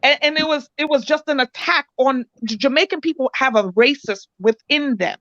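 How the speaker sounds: normal talking voice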